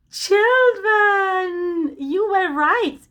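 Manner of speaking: high, loud cheering praise; excited praise. Acted. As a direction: celebrate the right answer loudly. Praising children after guess.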